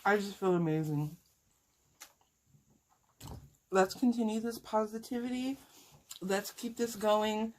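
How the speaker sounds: talking while chewing food; mouth full. Real, not acted. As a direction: speak through a mouthful of food. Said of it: "talking and eating is so hard".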